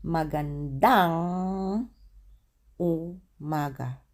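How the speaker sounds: Magandang umaga drawn out syllable by syllable; slow demo. Shown as a demonstration. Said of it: "So you say".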